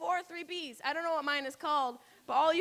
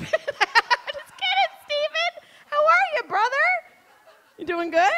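playful tease; 10 semitones higher, 6 dB louder than usual. Acted. Comic teasing audience member Steven, high and playful. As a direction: tease him with a grin.

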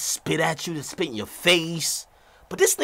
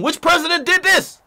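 higher, louder mock quiz question; quizzing examiner; 9 semitones higher, 5 dB louder than usual. Citizenship examiner quizzing an applicant, mock demanding. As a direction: put on a pushy examiner firing a question.

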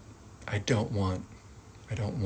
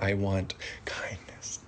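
whispered want; 2 semitones lower, 7 dB quieter than usual. Shown as a demonstration. Acting out a want sentence.

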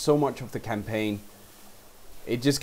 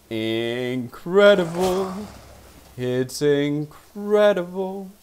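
slow, drawn out, louder wonder; awed; 5 semitones higher, 4 dB louder, much slower than usual. Real, not acted. Streamer savoring the big hit, slow awed wonder. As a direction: savour every syllable.

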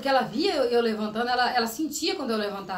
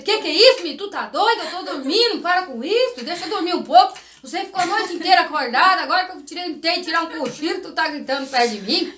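irritated scolding; 6 semitones higher, 8 dB louder than usual. Retold or imitated. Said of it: "Eu falei".

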